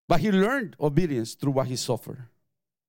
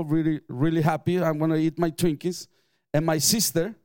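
normal talking voice

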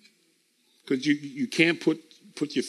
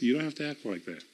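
much softer and fast; quiet rapid aside; 4 semitones lower, 10 dB quieter, much faster than usual. Retold or imitated. Holy Ghost gently correcting the believer, soft and fast. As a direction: rush it under the breath. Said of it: "the Holy Ghost is always gonna be telling us".

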